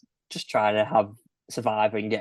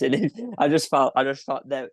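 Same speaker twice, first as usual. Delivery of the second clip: normal talking voice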